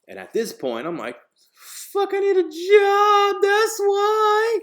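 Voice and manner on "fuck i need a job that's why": high, slow full-out scream; screamed inner thought. Acted. Job seeker's silent answer to the interviewer, desperate scream.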